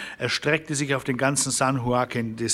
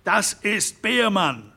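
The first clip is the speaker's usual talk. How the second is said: slow, high shout of the name; schreiend; 7 semitones higher, 3 dB louder, slower than usual. A narrator's reading. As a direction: cry out the name to the room. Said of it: "schrie Harron Derrick". (langsamer, hoher Ausruf)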